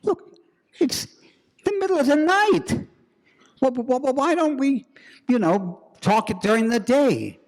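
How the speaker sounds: high, loud, stammering nervous pleading; flustered stammer. Acted. Professor pleads with a midnight visitor, high and stammering. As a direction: stumble over every word, rattled.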